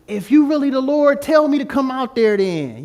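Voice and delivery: high, loud challenge; big-mouthed Peter. Retold or imitated. Peter dares Jesus from the boat, brash and loud. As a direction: call it out brashly, imitating a loudmouth. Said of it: "Peter got a big mouth".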